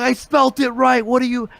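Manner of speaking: high-pitched mock protest at the audience; retold yelling. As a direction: act out an exasperated yell, pitched well up. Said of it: "I'm yelling at the audience".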